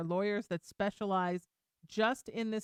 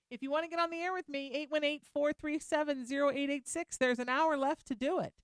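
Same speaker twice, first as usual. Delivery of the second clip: quick, higher host read of the phone number; radio call-in plug; 5 semitones higher than usual. Acted. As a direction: rattle off the number like a DJ.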